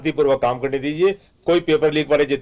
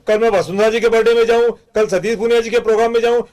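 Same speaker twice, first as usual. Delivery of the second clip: higher, mocking voice of a torn party worker; mock confused worker; 7 semitones higher, 3 dB louder than usual. Congress spokesman mocking confused BJP workers, higher mock dither. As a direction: ape the dithering worker who can't choose.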